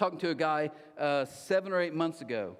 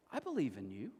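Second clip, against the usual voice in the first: tender; 6 semitones lower, 8 dB quieter than usual. Retold or imitated. Jesus speaks to an outcast.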